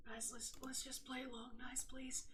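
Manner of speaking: real whisper, barely there. Real, not acted. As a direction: whisper to the others, giving up. Giving up, going along quietly.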